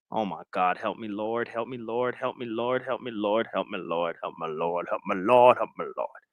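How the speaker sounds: low, very soft, repeated prayer under the breath; muttered plea. Pretend. Streamer exasperated by objectors, low muttered plea to the Lord. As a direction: mutter it over and over, sinking low.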